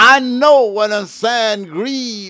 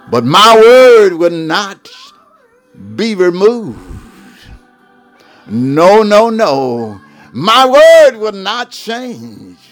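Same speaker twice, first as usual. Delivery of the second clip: booming proclamation; 8 dB louder than usual. Acted. Preacher proclaiming God's unchanging word, booming shout. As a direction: shout out God's promise, much louder.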